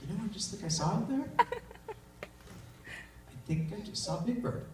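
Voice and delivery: ordinary talk, nothing marked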